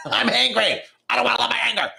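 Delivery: high, loud mock whine with a laugh; laughing mock grumble; mock petulant protest. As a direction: play the stubborn angry self. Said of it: "how do I fucking love my anger".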